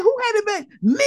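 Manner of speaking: high, laughing mock question; imitating Candace. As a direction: playfully mimic her surprise. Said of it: "She's like".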